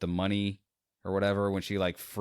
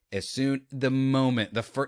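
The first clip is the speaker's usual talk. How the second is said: ordinary talk, nothing marked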